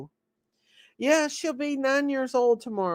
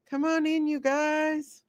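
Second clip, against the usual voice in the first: ordinary talk, nothing marked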